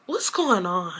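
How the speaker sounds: soft, low aside; hushed, re-enacted disbelief; quiet bewilderment. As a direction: drop the voice, as if baffled. Retold stunned reaction to crude comment.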